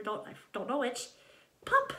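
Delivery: ordinary delivery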